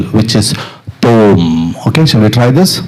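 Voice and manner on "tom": TOM low, held on the hum; drawn-out syllable. Shown as a demonstration. Percussion teacher giving students the third syllable, drawn out. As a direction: hold "tom" on a lowered note. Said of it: "you're going to say the third syllable".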